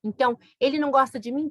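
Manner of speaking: ordinary talk, nothing marked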